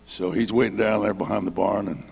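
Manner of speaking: ordinary delivery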